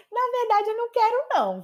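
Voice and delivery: high-pitched mock refusal; playful refusal. Shown as a demonstration. Teacher voices the invited friend: high playful refusal. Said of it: "é bem assim mesmo".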